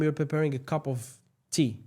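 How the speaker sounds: ordinary delivery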